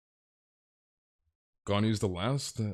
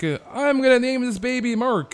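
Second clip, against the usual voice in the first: high, louder put-on mom voice; mock mom voice; 12 semitones higher, 5 dB louder than usual. Scene: mom naming her newborn baby, in a high mock voice. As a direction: gush it like a proud new mom. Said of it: "his mom was like".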